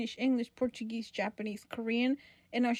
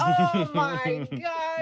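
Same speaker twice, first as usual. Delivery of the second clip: loud, long drawn-out exasperated groan of words; 5 semitones higher, 6 dB louder, much slower than usual. Retold or imitated. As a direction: stretch every word out, higher and louder. Retold exasperated reaction to film critic.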